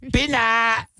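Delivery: high, exaggerated Vietnamese accent; mock Vietnamese accent. Shown as a demonstration. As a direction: pitch it way up in a put-on Vietnamese accent. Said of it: "say foreign words in their accent".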